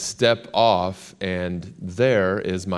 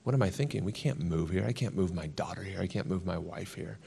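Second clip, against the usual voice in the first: much softer, turned inward; inner worried thought; 9 dB quieter than usual. Retold or imitated. Alone in the sun outside the meeting, softly doubting to himself. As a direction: say it under your breath, doubting yourself. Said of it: "And I would think".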